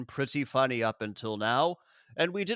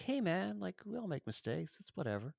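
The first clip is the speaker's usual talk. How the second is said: soft, laid-back chill guru; chill Raiden; 4 semitones higher, 10 dB quieter, faster than usual. Retold or imitated. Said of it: "very cool about Liu Kang losing Katana".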